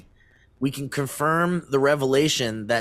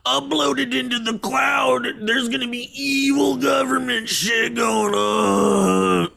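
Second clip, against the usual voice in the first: mock paranoid sci-fi fan; 11 semitones higher than usual. Mocking sci-fi fears with a high, shouted panic. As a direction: wail it like a doomsayer. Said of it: "and it's like".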